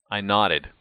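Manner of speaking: ordinary delivery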